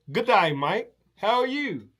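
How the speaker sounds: a cheery Aussie host welcoming you in; Australian waiter. Retold or imitated. Australian man greeting a hungry American at his table, cheery lightly voiced Aussie.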